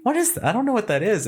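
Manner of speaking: high startled question; alarmed surprise. Retold or imitated. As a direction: react to the red on the wall. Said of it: "and I was like".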